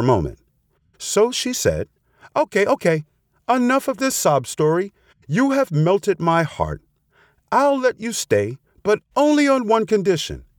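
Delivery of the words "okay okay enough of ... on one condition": high-pitched scheming fox; wily fox giving in. A narrator's reading. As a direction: play a fox pretending to relent, pitched high.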